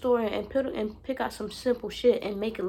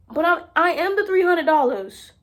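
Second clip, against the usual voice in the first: loud, high, insistent; loud declaration; 6 semitones higher, 8 dB louder than usual. Real, not acted. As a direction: declare your own worth.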